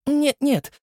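gently voiced young girl; Nastya's voice. A narrator's reading. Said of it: "покачала Настя головой". (высокий девичий голос)

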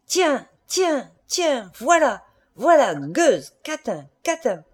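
high, punched-out insults; angry cries. A narrator's reading. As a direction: hurl each word like a slap. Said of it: "et criant". (criant de rage)

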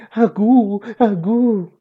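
put-on high cooing baby voice; high-pitched infant gurgle; happy baby babble. A narrator's reading. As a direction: coo like a delighted pretend baby. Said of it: "Me gustó tanto que dije".

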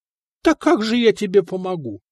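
a touch of feigned astonishment; mildly surprised question; mock surprise. A narrator's reading. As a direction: act amazed you could help. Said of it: "несказанно удивился нотарий".

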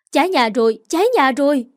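loud alarmed cry of fire; panicked shout. A narrator's reading. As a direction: yell the alarm to the house. Fire breaks out, people shout.

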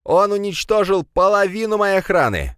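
high, strained outraged screech; creaky angry retort. A narrator's reading. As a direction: complain furiously in a high creaking voice. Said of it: "заскрипел в ответ Пегасов".

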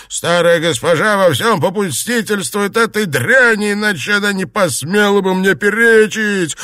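high, loud furious complaint; heated high-pitched tirade; angry shouting. A narrator's reading. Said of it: "крикнул Цзялянь".

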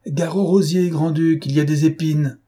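raillerie lancée bien plus haut; crie-lui ta moquerie par la fenêtre. Acted. Lupin raille le rival jeté. (moquerie criée vers la fenêtre)

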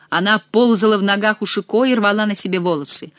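plain reading voice

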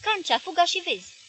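high, loud urgent command; shouted order. A narrator's reading. The owner ordering Cancea to run and check, a loud shout. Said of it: "strigă el".

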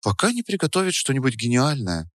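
put-on hushed girlish aside; stage whisper. A narrator's reading. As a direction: answer under your breath, confidentially. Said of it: "ответила Настя тоже шёпотом".

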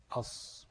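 أص ending on a held, hissed ص; lam merged into sad. Shown as a demonstration. Teacher isolating the merged sound, أص with long ص. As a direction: isolate the heavy s of أص, no L. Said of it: "مفيش لام".

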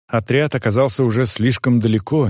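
ordinary delivery, nothing marked

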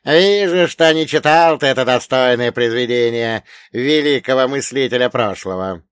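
loud, mocking reproach from an elder; sly old man. A narrator's reading. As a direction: needle your pupil as the dead mentor. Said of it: "ехидно прищурив левый глаз".

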